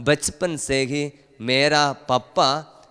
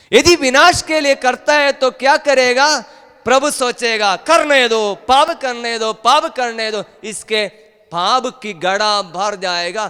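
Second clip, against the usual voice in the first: high, loud preacher's delivery; full-force preaching; 9 semitones higher, 6 dB louder than usual. Acted. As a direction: ring it out high, easing off toward the end. (ऊँची आवाज़ में जोशीला उपदेश)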